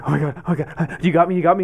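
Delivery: a nervous climber begging for reassurance; retold panic. Two feet up the rock, pleading with his friend, mock panic. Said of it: "I'm freaking out".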